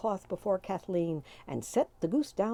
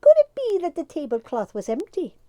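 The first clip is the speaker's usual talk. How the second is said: mocking fairy; 9 semitones higher, 9 dB louder than usual. A narrator's reading. The leader teasing an angry Tim. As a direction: needle him with a grin.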